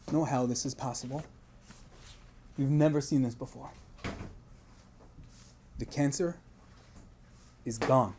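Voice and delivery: softly telling of a miracle; hushed awe. Retold or imitated. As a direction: let it drop to a stunned hush. Awed report of the miracle.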